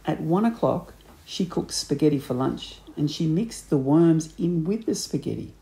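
plain reading voice

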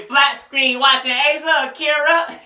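high, loud, slow rapper mimicry. Reviewer quoting a perverted bar, drawn-out high rapper impression. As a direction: stretch the bar out in a high yelp. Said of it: "[name] spitting the typical [name]".

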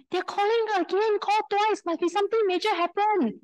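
high, rushed alarm; frantic worry. Retold or imitated. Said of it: "my mind went into a frenzy".

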